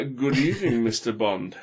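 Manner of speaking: mock villain greeting in a Yorkshire accent. Shown as a demonstration. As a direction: put on Yorkshire for a comic villain. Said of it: "set in a Yorkshire accent".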